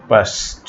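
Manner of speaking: "পাস্ট" ending in s plus t; final -ed as T. Shown as a demonstration. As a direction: close "পাস্ট" on a voiceless t. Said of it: "এটার ইডি এর উচ্চারণ হবে". ("পাস্ট"-এর শেষে ট উচ্চারণ)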